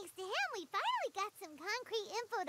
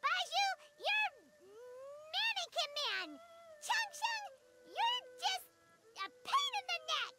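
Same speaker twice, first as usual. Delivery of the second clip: higher, huffy scolding; cross little tantrum, pitched up; indignant name-calling; 5 semitones higher than usual. Acted. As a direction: pout and fling the nicknames. Paimon angrily handing out nicknames.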